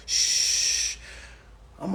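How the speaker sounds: ordinary talk, nothing marked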